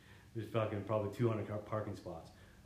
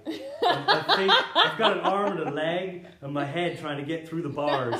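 real laughter through the words, much louder and higher; laughing while telling; 6 semitones higher, 11 dB louder, slower than usual. Real, not acted. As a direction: laugh helplessly as you tell it. Laughing at his own jail story.

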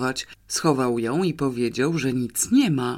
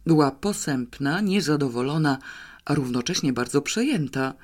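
plain reading voice

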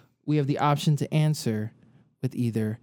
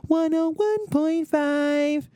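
slow, much higher, sung-out call sign; radio station ident; 14 semitones higher, much slower than usual. Pretend. Host doing the local station ident for his cohost. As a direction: announce the station like a radio jingle.

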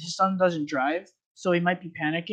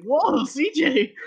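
mock surprise; 9 semitones higher, much slower than usual. Surprised at friend cursing.

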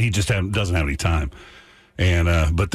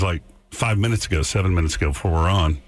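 ordinary delivery

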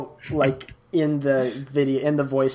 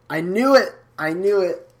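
excited; 5 semitones higher than usual. Real, not acted. Friend fell asleep on podcast.

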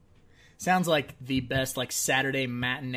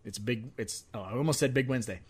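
normal talking voice

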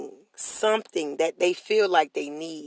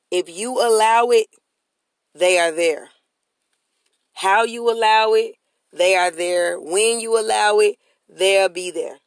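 louder, rhythmic refrain; preaching cadence; 5 dB louder than usual. Acted. Host preaching to listeners, rhythmic and louder. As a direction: hammer each line like a sermon.

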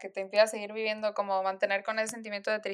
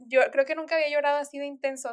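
usual speaking voice

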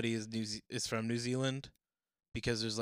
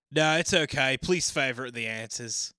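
put-on Australian accent; 2 semitones higher, 9 dB louder than usual. Reading a forum answer in an Australian accent. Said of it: "in my Down Under accent".

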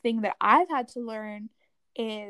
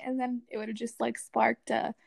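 ordinary delivery

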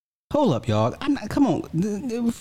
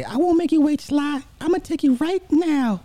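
man doing a woman's voice. Retold or imitated. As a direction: pitch way up into a swooning woman. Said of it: "my girl voice sucks".

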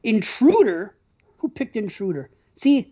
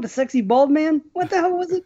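ordinary delivery

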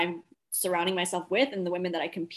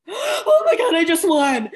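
squealing, much higher and louder; over the top excited winner; 10 semitones higher, 10 dB louder, slower than usual. Retold or imitated. Imitating the pageant winner's squealing over the top joy. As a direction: shriek it like a thrilled winner who just won. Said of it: "over the top ... super excited moment".

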